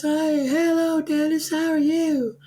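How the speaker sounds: very high, bright squeaky greeting; squeaky nurse voice. Retold or imitated. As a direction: squeak it like Minnie Mouse. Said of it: "squeaky voice like this".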